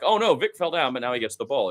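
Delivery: usual speaking voice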